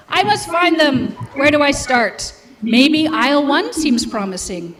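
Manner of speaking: usual speaking voice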